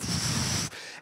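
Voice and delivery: long unvoiced whoosh; imitated air rush. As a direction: make a rushing-air whoosh. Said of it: "like, starts going".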